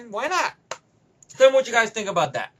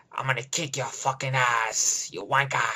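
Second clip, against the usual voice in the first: Jason Statham; 9 semitones lower than usual. Retold or imitated. Action star threatening his opponent, low mock tough-guy voice. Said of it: "Jason Statham with".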